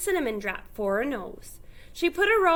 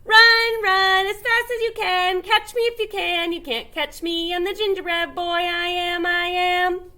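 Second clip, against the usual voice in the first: high, loud singsong taunt; taunting gingerbread boy; 6 semitones higher, 5 dB louder than usual. A narrator's reading. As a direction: tease them, singing it out. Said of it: "laughed and called out".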